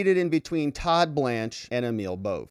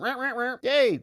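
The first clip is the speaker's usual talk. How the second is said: mock cheer; 11 semitones higher than usual.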